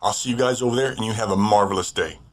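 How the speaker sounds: normal talking voice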